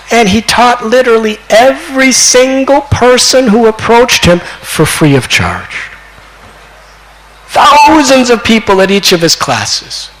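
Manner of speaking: high, emphatic preacher's storytelling; impassioned preaching. Acted. Preacher marvelling at Nimai teaching thousands free, impassioned preaching. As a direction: ring out the marvel, dropping at phrase ends.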